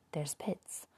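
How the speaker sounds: soft whispered caution; hushed warning. A narrator's reading. Gertrude warning Sophia about pits, whispered. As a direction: murmur the warning under the breath. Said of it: "Gertrude said".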